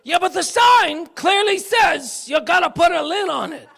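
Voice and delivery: high, loud laughing protest; mock indignant complaint. Preacher mimics a churchgoer's petty gripe, laughing and shouting. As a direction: laugh through a mock gripe about lids. Church member griping about coffee lids.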